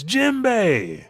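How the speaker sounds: high, loud drawn-out name; excited call. Acted. Luffy seeing Jinbei arrive, excited shout. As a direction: cry out the name to an arriving ally.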